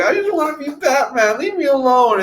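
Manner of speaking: high-pitched whiny tantrum; mock bratty whine; immature brat. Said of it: "just being an immature brat".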